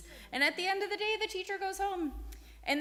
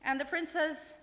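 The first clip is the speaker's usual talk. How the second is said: ordinary delivery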